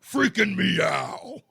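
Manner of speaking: slow, loud, comic panicked yell; hammed-up frightened cry; mock freaked-out shout. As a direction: play the startled kitten shouting. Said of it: "cats freak out".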